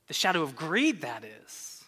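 low, fading mock Yoda voice; a hint of Yoda, dropping away low; Yoda impression. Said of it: "He says this".

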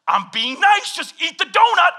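loud, high exasperated plea; resentful. Retold or imitated. Husband pushing wife to take the last donut, exasperated. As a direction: snap it in mock frustration. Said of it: "I'm getting resentful".

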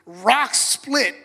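high, loud proclamation; full-force preacher proclaiming; preaching climax. Acted. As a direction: lift the congregation with power.

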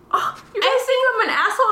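high, quick incredulous question; astonished retold reply, fast and high; mock surprise. Retells her reply to friends' intervention.

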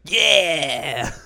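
loud, high, drawn-out cheer; eager yell. Retold or imitated. Chica offered grilled oranges, an eager imitated shout. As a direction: burst out with delighted agreement.

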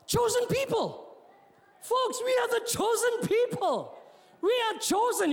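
high, ringing preacher's declaration; emphatic preaching. Real, not acted. Preacher telling folks they are chosen, emphatic. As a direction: proclaim it to the congregation, pitched high.

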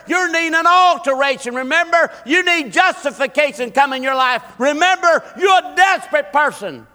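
very high, shouted sermon; full-force preaching. Real, not acted. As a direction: cry out to the congregation at full pitch.

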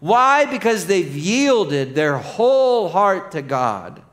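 loud, high, incredulous build; full-force preaching. Real, not acted.

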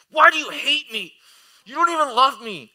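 loud, high-pitched mock tantrum; wailing child. Son accusing dad over refused cereal, melodramatic wail. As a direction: imitate a hurt kid protesting at full volume.

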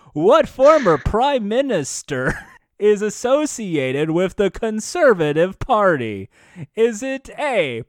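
hammed-up host, drawing out the question; Wink Yahoo. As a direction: read it out grandly like a game show host. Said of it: "wanna see Wink Yahoo do Millionaire".

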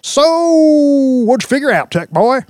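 booming high-pitched deputy's holler; bellow. A narrator's reading. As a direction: burst in bellowing, stretching the first word. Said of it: "Deputy Brick Talboys' signature bellow".

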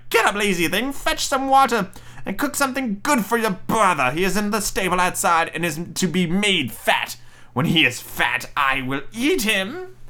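high, bossy witch voice; crying witch. Acted. Witch waking Gretel with orders, high crying voice. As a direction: order the child about, slowing to a high, menacing finish. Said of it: "and cried".

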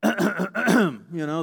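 loud fake cough for attention; a housewife hinting loudly at her idle sister; pointed throat clearing. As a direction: clear your throat to be noticed. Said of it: "Maybe she goes".

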